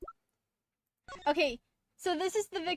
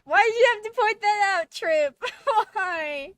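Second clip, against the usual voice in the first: much higher and louder, half laughing; exasperated outcry; 7 semitones higher, 9 dB louder than usual. Real, not acted. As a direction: cry out at the character in mock despair. Streamer reacts to game character.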